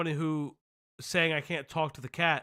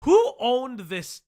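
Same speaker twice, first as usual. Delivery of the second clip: indignant; 7 semitones higher, 8 dB louder, much slower than usual. Real, not acted. Streamer indignant question.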